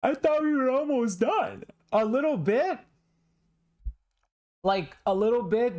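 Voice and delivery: high, pleading disbelief; dismayed disbelief. Real, not acted. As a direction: whine that it isn't over. Dismayed that the trial continues.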